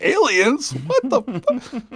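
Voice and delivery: high-pitched, drawn-out cry of alarm; mock startled yell. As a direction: yelp it out, re-enacting your own shock. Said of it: "I started yelling".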